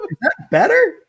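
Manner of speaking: high, loud laughing question. Real, not acted. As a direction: ask it through a laugh. Joking question while laughing.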